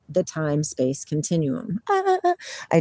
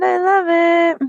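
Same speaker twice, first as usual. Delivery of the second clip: delighted; 11 semitones higher, 5 dB louder than usual. Real, not acted. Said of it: "I love that".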